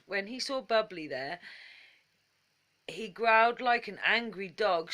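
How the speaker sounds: usual speaking voice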